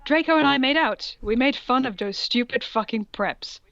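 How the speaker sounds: ordinary talk, nothing marked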